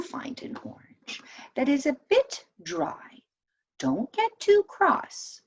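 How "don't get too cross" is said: ordinary delivery, nothing marked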